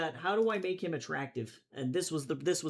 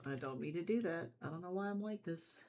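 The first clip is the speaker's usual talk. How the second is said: muttering; 8 dB quieter than usual. Real, not acted. Self-deprecating aside.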